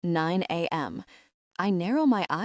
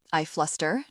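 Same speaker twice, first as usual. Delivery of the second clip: ordinary delivery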